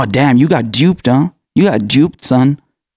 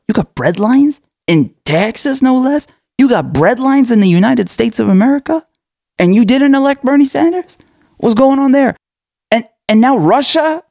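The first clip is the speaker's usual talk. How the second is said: rising incredulity; 9 semitones higher than usual. Real, not acted. Mocking American viewers, rising higher in disbelief. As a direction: pitch climbs as the disbelief builds.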